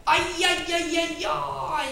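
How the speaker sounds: slow, high moaning ajjaj; groaning ajjaj. Acted. Bear moans at the bad news, slow groan. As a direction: moan in dismay. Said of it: "nyögött fel Medve Medár".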